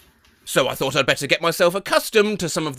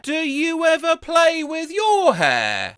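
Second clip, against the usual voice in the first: playful dad voice; 10 semitones higher, slower than usual. Retold or imitated. Dad fixing daughter's hair.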